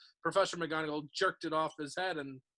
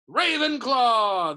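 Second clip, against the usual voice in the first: hat's announcement; 6 semitones higher, 11 dB louder, much slower than usual. A narrator's reading. Hat announcing the house.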